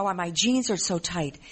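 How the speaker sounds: normal talking voice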